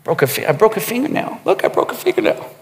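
soft, quick whispered prissy complaint; mock girly whine. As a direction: squeal it like a fussy girl. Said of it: "Guys, don't ever say that".

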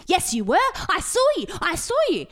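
high, loud accusing; loud high accusing insistence; mock insistent accusation. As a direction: jab it like a stubborn old man. Old man insists, imitated.

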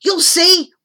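high, loud parting shout. A narrator's reading. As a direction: shout it back while leaving. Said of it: "shouted Mick".

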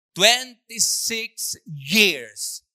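"Twenty-six years" stretched out very slowly and loud; mourning what was lost; drawn-out lament. Real, not acted. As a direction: lean on "Twenty-six years", slow and heavy. Preacher lamenting broken marriage.

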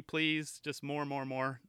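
ordinary talk, nothing marked

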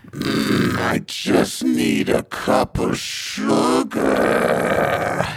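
low, slow, unvoiced creepy whisper; Ma's low whisper. Ma Scamarelli whispering to Billy Bonka, low and creepy. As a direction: breathe it out low and menacing. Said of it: "She stares at Billy Bonka and says".

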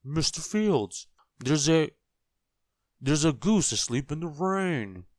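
high, loud, excited announcement; urgent calling. A narrator's reading. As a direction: blurt the news across a farmyard. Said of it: "he called as he ran".

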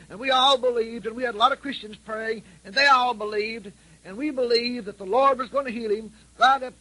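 high, loud, aggrieved woman's voice; retold widow's complaint. Widow telling the preacher her husband died, aggrieved and high. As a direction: voice her bitter grief up high. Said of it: "But she said".

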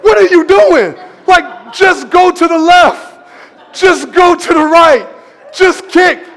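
high, loud mock exasperation; exasperated. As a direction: plead loudly with a hopeless dancer. Acted exasperation at a stubborn dancer.

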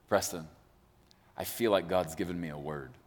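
low, hushed solemn confession; serious. Retold or imitated. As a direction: imitate a man gravely breaking heavy news. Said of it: "It seems serious".

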